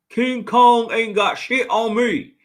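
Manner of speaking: a bad American accent, dragged out slowly; poor American accent. Quoting Training Day to chat in a bad American accent. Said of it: "such a poor accent".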